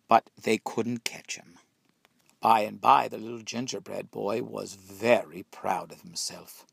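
ordinary delivery

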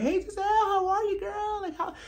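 very high, cooing mock greeting; mock Wendy greeting. Mocking Wendy greeting Gizelle, squealing high. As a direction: squeal it like an overly sweet friend.

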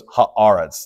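"haaretz" broken as ha, brief stop, AH-retz; stop between syllables. Shown as a demonstration. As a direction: say haaretz slowly with a glottal catch after "ha". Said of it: "a bit of a stop between the two".

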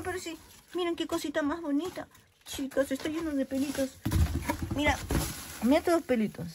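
aguda y más suave, con ternura; arrúllalo como algo adorable; tender cooing. Real, not acted. Admira regalo con ternura. (voz tierna y aguda admirando el regalo)

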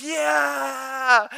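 high shouted yeah; crowd shout. Retold or imitated. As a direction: roar it like a crowd. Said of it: "everyone goes".

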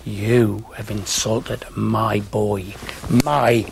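lightly voiced offended mother defending her boy; the mum telling the commentator off, slow and stately; indignant mum. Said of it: "Pam, his mum, stood there".

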